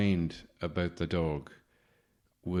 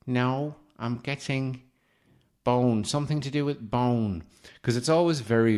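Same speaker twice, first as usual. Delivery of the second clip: mock psychic; 5 semitones higher, 6 dB louder than usual. Mocking psychic reading.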